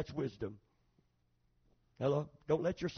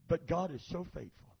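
ordinary talk, nothing marked

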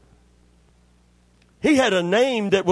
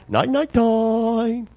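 slow, higher bird voice with a long held last word; sleepy bird mimicry; 5 semitones higher, much slower than usual. Sleepy macaw asks to be covered up, drawn-out bird mimicry. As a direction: draw it out like a talking bird. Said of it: "out of the clear blue, he'd say".

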